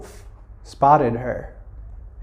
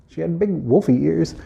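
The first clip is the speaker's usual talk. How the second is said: usual speaking voice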